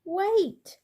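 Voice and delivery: high loud call. A narrator's reading. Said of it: "called Mister Brown".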